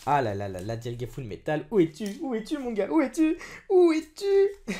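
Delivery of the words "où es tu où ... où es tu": building plea. Real, not acted. Youtuber searching packs for Dialga, building pleading calls. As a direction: call out to the missing card.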